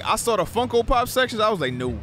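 ordinary talk, nothing marked

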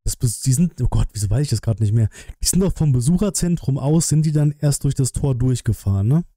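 ordinary delivery

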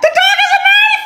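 high panicked shriek; shrill hammed-up terror at a knife; mock panic. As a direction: scream it in comic alarm.

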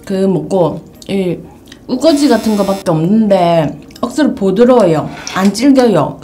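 talking while wiping mouth; slightly muffled by tissue; hand over mouth. Real, not acted. Seen 0: Tissue held at mouth from 0.8s through the labelled words.

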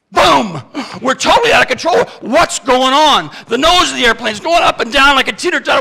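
frantic cockpit alarm, rushing the words; panicked alarm. Acted. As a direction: relive the plane going out of control. Reliving the in-flight emergency.

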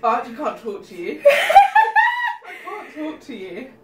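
can't stop laughing mid-sentence. Real, not acted. Laughing, too spicy to talk.

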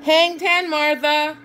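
high, loud shout across the surf. A narrator's reading. Farmer shouting to his cow on the surfboard, excited. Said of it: "Rob shouted".

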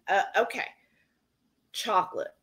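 normal talking voice

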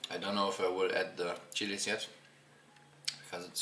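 talking with food in mouth; savoring while speaking; chewing. Real, not acted. Seen 2: Bite taken just before; mouth working on food during speech.

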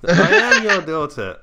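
put-on high, loud laughing girl voice; man doing a woman's voice. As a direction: laugh through a high child voice. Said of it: "and he goes".

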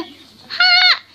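high, loud funny voice; Stampy. Acted. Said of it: "in a really funny voice".

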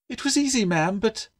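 very high, smug little pupil; crowing schoolgirl. A narrator's reading. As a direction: crow to the teacher in a high voice. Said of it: "Violet's insufferable crowing".

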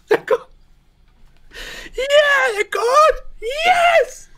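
extremely high, loud shrieks; hammed-up screaming. Co-host celebrating the deleted videos, hammed-up shriek. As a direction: scream it in mock hysteria. Mock hysterical joy at deletion.

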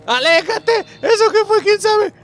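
high, loud frantic pleas; panicked shouting. Real, not acted. Gamer begging his companion for help, panicked.

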